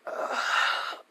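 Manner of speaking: soft breathy groan of a sore body; pained groan. Acted. Injured boy waking in pain, a soft groan.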